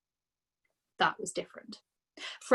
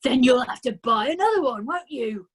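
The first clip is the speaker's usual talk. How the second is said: a louder, bullying father voice; sneering father; 4 semitones higher, 8 dB louder than usual. A narrator's reading. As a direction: taunt her like a smug dad. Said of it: "the father said".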